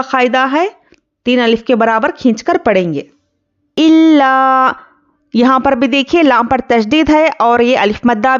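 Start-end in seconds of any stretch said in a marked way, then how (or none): 3.8-4.7 إِلَّا with a hard, held doubled lam; slow Quranic إِلَّا with firm shadda; stressed doubled lam. Shown as a demonstration. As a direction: lean hard on the lam of إِلَّا, then stretch the alif. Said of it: "إِلَّا اس طرح سے پڑھیں گے".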